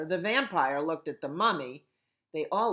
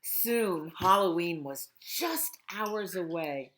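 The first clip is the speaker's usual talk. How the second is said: ordinary delivery, nothing marked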